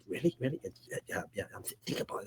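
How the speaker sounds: soft, hushed mock love talk fading to a whisper; overheard sweet talk. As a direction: murmur it like lovers behind a door. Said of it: "he just hears, like, some love".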